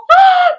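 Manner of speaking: sharp, very high, loud gasp; excited gasp. Real, not acted. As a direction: gasp in delight. Excited about McGonagall's entrance.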